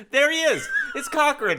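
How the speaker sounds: high, loud gleeful cry; excited shout. Retold or imitated. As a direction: shout it out like spotting a friend. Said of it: "he's like".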